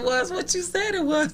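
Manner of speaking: high-pitched, giggly mock answer; reply as if unable to stop laughing; retold through laughter. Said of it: "I kept laughing".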